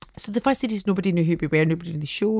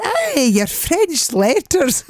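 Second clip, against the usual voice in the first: put-on old man voice; 7 semitones higher, 5 dB louder, much slower than usual. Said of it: "And he went".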